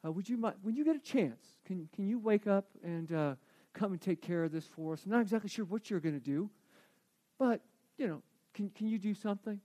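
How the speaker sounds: hushed, timid plea to wake him; soft apologetic wake-up call; soft pleading disciple. Acted. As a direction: tiptoe in and whisper a nervous request. Disciples voiced waking Jesus softly.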